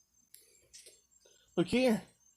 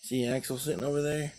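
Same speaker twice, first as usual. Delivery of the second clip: ordinary delivery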